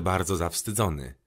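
ordinary delivery

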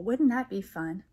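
ordinary delivery, nothing marked

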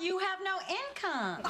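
slow, high-pitched echo of the complaint; pointed retort. Acted. Mother answering daughter's list of complaints, slow pointed retort.

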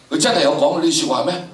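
ordinary delivery

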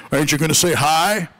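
deep booming Texan greeting, lightly done. Retold or imitated. Secretary greets distracted pastor, booming low voice. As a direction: boom it out low like a big man. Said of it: "I hear this booming voice".

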